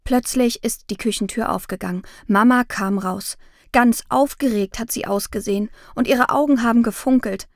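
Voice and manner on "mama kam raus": plain reading voice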